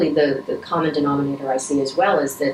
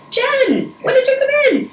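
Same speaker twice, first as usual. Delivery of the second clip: much higher, louder retold voice of the surgeon; surgeon's surprised call; 6 dB louder than usual. Surgeon looks up from surgery and spots her, high surprised call. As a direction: pitch the surgeon's line way up, like a friendly surprised hello.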